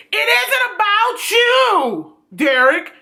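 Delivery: high, slow scolding at Derek; exasperated retort. Real, not acted. Addressing Derek angrily in recap.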